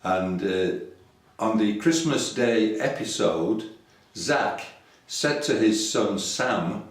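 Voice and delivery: ordinary talk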